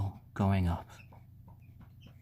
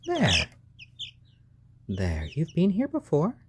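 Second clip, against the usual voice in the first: normal talking voice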